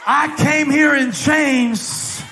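slow declaration climbing to a high shout; rising preaching shout. Acted. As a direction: drag out each phrase, building to full force.

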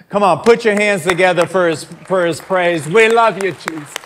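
high, loud praise shout; shouted worship. Acted. Pastor rousing the congregation to worship, loud and high. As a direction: lift the whole room with a full-voiced cry.

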